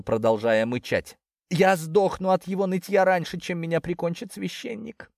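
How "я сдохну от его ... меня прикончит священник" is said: complaining. A narrator's reading. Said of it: "пожаловалась кошка".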